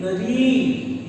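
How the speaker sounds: नदी sung out slowly, holding the long ई; drawn-out नदी with a lengthened ई; long final ई. Shown as a demonstration. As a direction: stretch नदी so the big ई rings out. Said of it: "इसी प्रकार बड़े ई".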